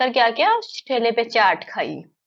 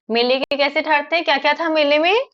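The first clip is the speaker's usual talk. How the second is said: normal talking voice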